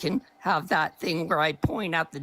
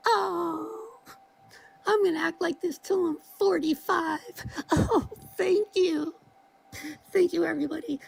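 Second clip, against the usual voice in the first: mock gushing gratitude; 11 semitones higher than usual. Mocking the streamer's gratitude.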